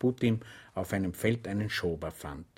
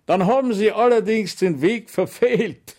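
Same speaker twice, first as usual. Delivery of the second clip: much higher, louder mock; mocking sergeant; 11 semitones higher, 9 dB louder than usual. Sergeant mocking Schweig's lost way, higher and louder. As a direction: point out his mistake with scorn. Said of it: "sagte der Wachtmeister spöttisch".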